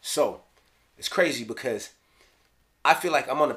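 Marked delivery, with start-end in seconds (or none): none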